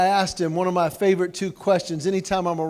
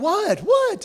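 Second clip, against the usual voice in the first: startled confusion; 11 semitones higher than usual. Retold or imitated. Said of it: "I'm like".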